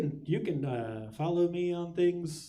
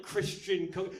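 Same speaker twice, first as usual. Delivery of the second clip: ordinary talk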